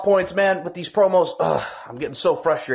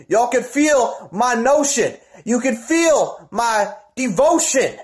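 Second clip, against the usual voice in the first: drawn-out promo call; 6 semitones higher, slower than usual. Acted.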